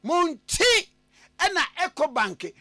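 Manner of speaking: high, loud radio announcer call-out; ad read. Acted. Host hailing Ghanaians for Eco Bank, loud ad read. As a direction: call out to all Ghana with punchy pauses.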